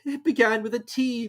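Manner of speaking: high, meek reply; high meek Hatter; helpless Hatter. A narrator's reading. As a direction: answer timidly. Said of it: "the Hatter replied".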